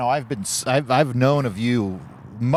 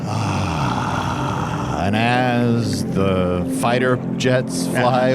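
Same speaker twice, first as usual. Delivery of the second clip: mock guided meditation; much slower than usual.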